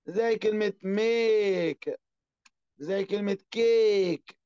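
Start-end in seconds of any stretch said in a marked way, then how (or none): 0.8-2.0 ميك with a slow, held "ay"; long A in ميك. Shown as a demonstration. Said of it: "أنا بمد في الإيه". 3.5-4.4 كيك with the "ay" held long. Shown as a demonstration. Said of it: "أنا بمد في الإيه".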